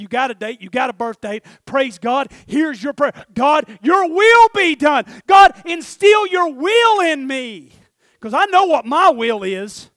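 loud shouted prayer building to long, high cries; full-force preaching. Real, not acted. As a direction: preach it at the top of your lungs, stretching the key words.

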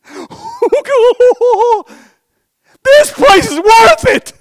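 very high, loud acted shout; ecstatic discovery. Man in parable finds treasure, ecstatic shouting. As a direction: shriek it out, overjoyed at the find.

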